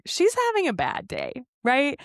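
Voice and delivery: mother and daughter deciding to let it go, lightly; reasoning it away. Retold or imitated. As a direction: talk yourself out of being upset. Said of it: "we're like".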